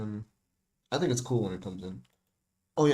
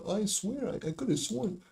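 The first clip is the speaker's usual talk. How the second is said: high-pitched mocking voice, far above usual; mock protest; 10 semitones higher than usual. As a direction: act out a put-on "I swear" in a much higher voice. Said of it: "I'm not being here like".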